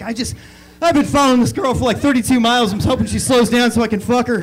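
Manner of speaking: loud, winded buddy on the phone; out of breath. Acted. Buddy answering a call mid-chase, acted breathlessness. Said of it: "he's just out of breath".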